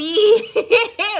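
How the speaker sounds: high, loud cackling laugh. Real, not acted. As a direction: explode into laughter. Tickled by W sounding like V.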